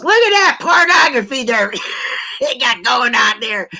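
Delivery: loud, high imitated New York accent. Daughter joking about a passing truck, mock New York accent. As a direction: put on New York, laughing midway. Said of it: "She's talking in New York accent".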